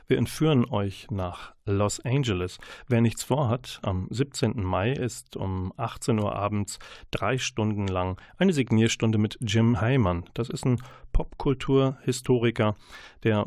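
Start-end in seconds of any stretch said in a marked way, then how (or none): none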